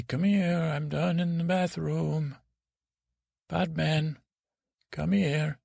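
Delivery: high-pitched call for someone. Acted. Calling out from the bathroom.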